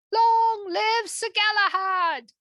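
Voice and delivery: high, loud, slow cheer; cheering cry. Acted. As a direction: raise a rousing toast. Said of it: "cried Sir Gawain".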